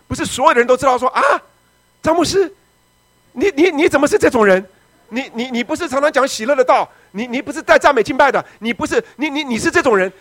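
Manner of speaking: loud, high, disbelieving reproach; shocked accusation. Retold or imitated. Churchgoers shocked at their pastor, loud accusing voice. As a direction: cry out in scandalised disbelief.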